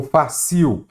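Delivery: FÁCIL said as "fa-CIU", end stressed; stress shifted to last syllable. Shown as a demonstration. Teacher shows what the word turned into, end-stressed. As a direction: read "fácil" with the weight on CIL. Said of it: "aqui virou fácil".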